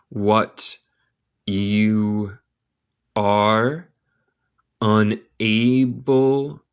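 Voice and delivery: very slow, each word set apart; word-by-word model. Shown as a demonstration. Teacher reading the moral to learners, word by word. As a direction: dictate it with long pauses.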